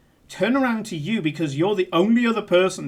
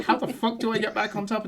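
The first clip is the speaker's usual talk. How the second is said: frustrated thought; 3 semitones higher, 5 dB quieter than usual. Retold or imitated. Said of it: "she's just like".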